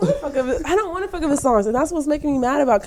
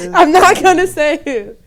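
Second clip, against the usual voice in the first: loud, higher laughing imitation; laughing quote; 5 semitones higher, 7 dB louder than usual. Co-host teasing a friend's careful non-answer, laughing quote. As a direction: quote them while cracking up.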